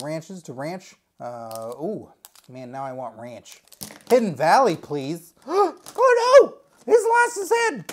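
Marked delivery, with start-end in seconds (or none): 5.5-7.8 high, loud gasp and a hammed-up cry of oh no; mock horror. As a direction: gasp like a tragedy just struck. Toy screwdriver found headless.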